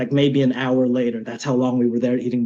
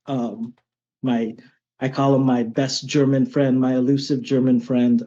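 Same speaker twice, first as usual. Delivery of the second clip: ordinary delivery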